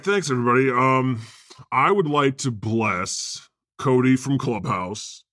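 ordinary talk

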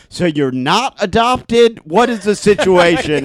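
loud, slow, high-pitched mock interrogation; incredulous questioning. Imitating a friend grilling a party guest, incredulous questioning. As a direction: drag it out like someone baffled and prying.

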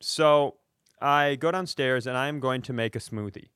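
ordinary delivery

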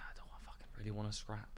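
unvoiced private doubt, barely there; whispered thought. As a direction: whisper it to yourself. Inner thought after the loss.

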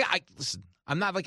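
ordinary talk, nothing marked

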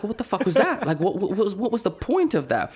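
high-pitched, laughing mock bafflement; exasperated disbelief. Storyteller escorted out empty-handed, high mock-baffled outburst. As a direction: squeal the questions in comic exasperation. Retold baffled reaction to being rebuffed.

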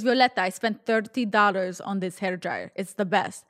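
put-on insistent Russian-accented mother; mock Russian mom, rolled r and all; poor Russian accent. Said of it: "my really poor Russian accent".

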